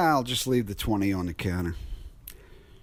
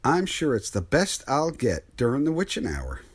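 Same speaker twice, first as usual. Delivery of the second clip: usual speaking voice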